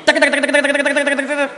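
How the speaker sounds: long rapid "taka-taka" rattle on a high monotone; high, fast gibberish that starts loud and eases off; imitated tongues babble. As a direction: parody a tongues healer with nonstop meaningless syllables. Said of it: "hat jemand geheilt mit Sprachenreden".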